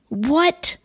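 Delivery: high, rising, incredulous "what"; shocked disbelief. Retold or imitated. Couple hearing police report a death, shocked disbelief. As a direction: let the pitch leap up in disbelief.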